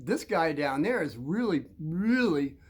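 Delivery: ordinary delivery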